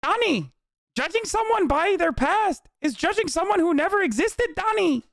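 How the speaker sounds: very high-pitched character impression; Lang Buddha. Quoting what he used to say to Donnie, Lang Buddha impression. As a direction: redo the quote in a strained high voice, addressing someone. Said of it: "what he used to say to Donnie".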